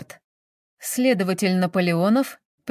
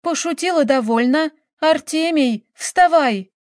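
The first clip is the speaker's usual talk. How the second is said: high-pitched, rising urgency; urgent pleading; 7 semitones higher, 4 dB louder than usual. A narrator's reading. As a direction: plead louder towards the last word. Countess urging her motionless husband. (отчаянно, настойчиво)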